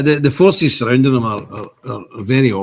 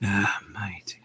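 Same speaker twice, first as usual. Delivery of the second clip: whisper; 5 semitones lower, 11 dB quieter than usual. Real, not acted. Whispered aside reading chat.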